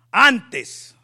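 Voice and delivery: loud Antes, punched; emphatic shout. Real, not acted. Preacher to the congregation, a sudden loud Antes. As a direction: bark the word to grab attention.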